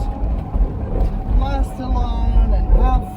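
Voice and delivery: loud, high, projected over the engine. Real, not acted. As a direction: call it out over the noise of the drive. Talking over engine while driving.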